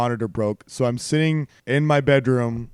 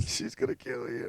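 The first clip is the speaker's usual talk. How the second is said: whispered aside; 12 dB quieter than usual. Acted. Repeated as a whisper.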